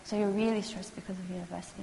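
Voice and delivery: quiet, tentative counselor reflection. Shown as a demonstration. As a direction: hand it back softly, checking. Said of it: "I'm very tentative".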